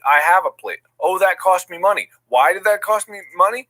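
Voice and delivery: ordinary delivery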